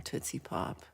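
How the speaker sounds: a tiny breathless whisper. Real, not acted. Musing on a Tootsie Pop name to herself, whispered.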